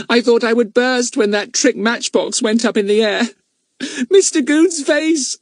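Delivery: put-on high boyish voice bubbling over with glee; Pip, gleeful boy. Pip to Fatty, high and gleeful. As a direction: relish the memory of the prank.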